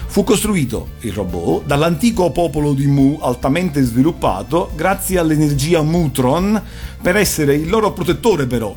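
ordinary talk, nothing marked

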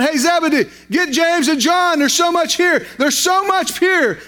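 calling out to the other fishermen, lifting the voice; retold yell. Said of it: "and he yelled".

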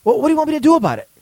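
high, fast mock nagging wife voice; mock exasperated wife. Husband fakes a call, voicing his wife as exasperated. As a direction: play the fed-up wife on the phone. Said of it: "fake conversation with myself".

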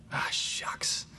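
whispered ah shucks. Pretend. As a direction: breathe it out as a whisper. Whispered disappointment.

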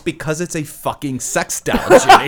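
a bar worker answering Jimmy, half-laughing and exasperated; exasperated laughing retort. Retold or imitated. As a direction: blurt it out as if you can't believe the question.

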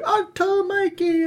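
put-on high, slow excited kid calling uncle. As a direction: squeal it like a little boy. Nephew voiced in story.

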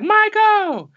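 very high-pitched mock scream; imitated fan scream. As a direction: squeal it out like an excited fan spotting their idol. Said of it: "they start screaming that stuff".

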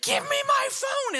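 high, loud cry for her phone; retold shout. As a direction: shout it high as the driver grabbing back. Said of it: "and she's like".

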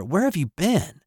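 astonished question. Retold or imitated. Child asking returned mom where she has been, astonished.

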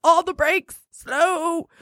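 high, slow, drawn-out words; braking plea. Real, not acted. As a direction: stretch each word to pump the brakes. Urging the suitor to slow down.

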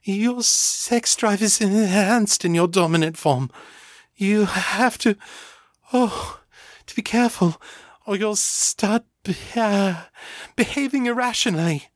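fighting to finish the warning; stammering. A narrator's reading. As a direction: Ava stammering between moans. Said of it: "Ava stammered".

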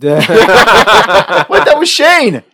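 high, laughing comic bewilderment; hammed-up shocked protest; mock disbelief. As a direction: climb in pitch into an indignant squeal. Acted bewildered victim.

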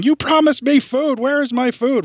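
high, slow whiny cat voice. Retold or imitated. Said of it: "Mac yelling at me in cat voice going".

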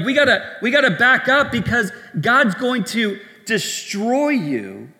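high, loud mock panic, dropping on the last word; alarmed urging. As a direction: urge everyone back in acted alarm. Voicing onlookers backing away in alarm.

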